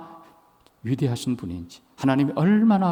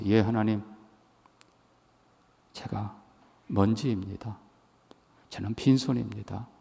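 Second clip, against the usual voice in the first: soft, lower, pleading prayer; humble hushed prayer; 4 semitones lower, 9 dB quieter than usual. Acted. Pastor voicing Job begging God for mercy, hushed and low. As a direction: plead for mercy almost under the breath.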